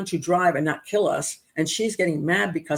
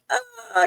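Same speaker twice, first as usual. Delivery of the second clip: high-pitched frazzled "ah"; a stressed yelp as time runs out; frantic exclaim. Retold or imitated. Frantically setting up for show.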